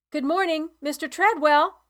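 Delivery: high, loud greeting called across the yard; calling out. A narrator's reading. Ain calls out to Mister Treadwell across the farm, loud and high. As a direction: sing out his name from outside.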